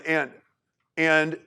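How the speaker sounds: ordinary talk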